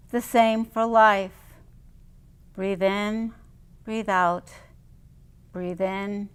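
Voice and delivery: ordinary talk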